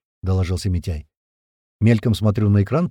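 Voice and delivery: plain reading voice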